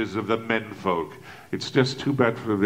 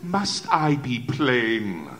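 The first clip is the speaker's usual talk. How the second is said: usual speaking voice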